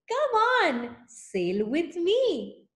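high come on, then slow invitation; inviting whale. Acted. Whale inviting the snail to sail, lightly voiced. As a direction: coax the snail aboard. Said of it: "said to the snail".

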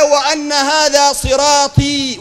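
emphatic drawn-out scripture; Quran recitation. Acted. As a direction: stretch the verse out high. Reciting verse as climax.

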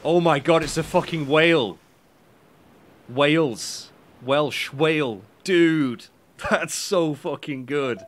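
loud, delighted outburst; real astonished delight; excited amazement. Real, not acted. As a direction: crow over a brilliant pun, loud and thrilled. Delighted by Welsh whale pun.

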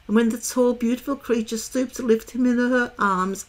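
ordinary delivery, nothing marked

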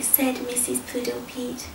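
ordinary delivery, nothing marked

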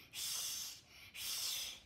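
breathy cat hiss; angry cat hiss. Shown as a demonstration. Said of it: "Angry cat".

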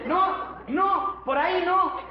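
high, urgent no's; calling out warning. Acted. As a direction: stop the cow going the wrong way. Frena a la vaca. (advertencia aguda y apremiante)

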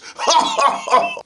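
loud, drawn-out character chortle; put-on politician's laugh; hearty mock laugh. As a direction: chortle heartily at your own joke. Acted Boris laughs at own joke.

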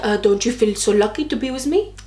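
badly faked French-accented boyfriend. Arrogant date repeats his question, bad French accent. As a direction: put on a clumsy French accent. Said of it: "doing a really bad French accent".

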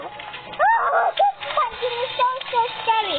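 ordinary talk

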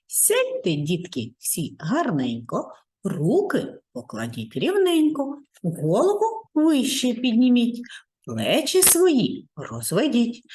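put-on slow, sing-song rhyme for children; classroom rhyme. Shown as a demonstration. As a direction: chant the warm-up rhyme to the kids. Rhyming warm-up addressed to children. (наспівна віршована розминка для дітей)

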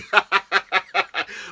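light laugh at her own slip; amused laugh. Real, not acted.

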